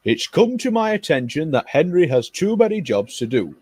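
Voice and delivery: bossy railway controller. Acted. Controller tells Gordon he must pull the Kipper. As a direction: lay down the new orders.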